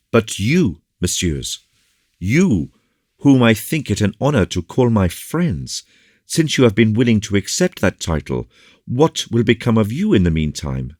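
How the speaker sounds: urgent, troubled officer; agitated. A narrator's reading. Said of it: "very much agitated at taking leave".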